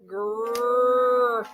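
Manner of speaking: Grrr held long on the opening sound; stretched like a turtle. Shown as a demonstration. Teacher stretching grocery for the class. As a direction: draw out the g-r sound slowly. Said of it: "let's say it like a turtle".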